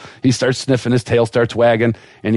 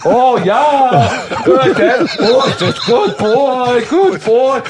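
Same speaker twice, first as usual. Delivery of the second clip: high, slow, hammed-up Russian moaning praise; Russian accent; 13 semitones higher, 4 dB louder, much slower than usual. Russian vet milking the dog, high drawn-out Russian accent. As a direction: build to a mock-ecstatic Russian woman, pitched way up. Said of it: "But her talking".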